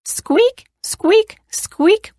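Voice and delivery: high rising squeaks; squeaky bed. A narrator's reading. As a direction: imitate a squeaky bed, pitch flicking up. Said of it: "went the bed".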